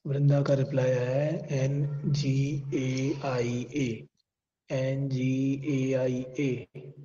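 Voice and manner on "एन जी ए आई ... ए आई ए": slow letter-by-letter spelling. Shown as a demonstration. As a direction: spell each letter slowly. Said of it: "गलत pronounce कर रहा हूँ". (धीरे-धीरे अक्षर बोलना)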